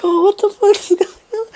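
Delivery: very high, tearful mock panic; panicked wail. Passengers freaking out while driving, high panicked wail. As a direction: imitate a freaked-out squeal.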